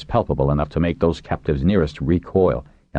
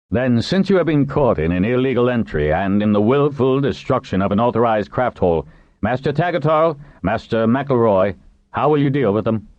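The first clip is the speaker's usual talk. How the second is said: formal charge in a higher voice; authority figure; 7 semitones higher than usual. Acted. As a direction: state the offence with authority, pitched up. Narrator voices Natan passing to masters.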